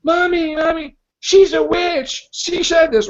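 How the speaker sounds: put-on high, slow whiny kid voice; mock kids tattling. Retelling the kids telling their mother, high whiny plea. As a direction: plead it like scared children. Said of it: "they're like".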